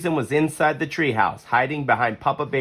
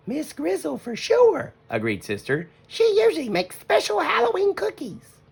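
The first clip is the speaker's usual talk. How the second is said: put-on much higher girlish cub voice; little sister voice. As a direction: sound eager and sweet.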